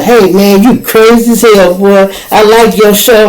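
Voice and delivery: lightly voiced jailer who's a fan; turnkey voice. Jailer greets the comic he recognizes, lightly voiced fan. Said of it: "The goddamn turnkey knew who I was".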